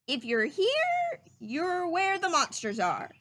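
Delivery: mild rabbit reading a sign. Acted. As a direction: read the sign out slow and careful. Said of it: "Rabbit read".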